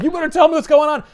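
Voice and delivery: loud, fast, high demand; mock tough threat. Spider-Man grabbing a guy, threatening him loudly. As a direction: grill him hard and fast. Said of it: "Spider-Man grabs him and he's like".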